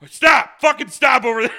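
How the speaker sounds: high, loud yell at people crossing; yelling at people on the rope bridge to stop; shouted command. Retold or imitated. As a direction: bellow to stop the crowd. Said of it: "and I'm like".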